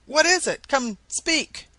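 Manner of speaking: high, pressing cry; urgent demand. A narrator's reading. Crowd presses the secretary to explain, urgent and high. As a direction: push him to speak, pitched high. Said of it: "exclaimed every one around him".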